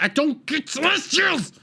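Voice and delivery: high-pitched exasperated cry; exasperated whine. Real, not acted. Reviewer complaining about the monster's lore, high exasperated whine. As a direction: squeal it in comic frustration.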